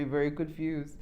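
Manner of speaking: ordinary talk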